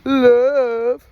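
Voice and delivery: high, loud punchy peace; hyped game call. Shown as a demonstration. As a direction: sell the word like a game host. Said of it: "you go".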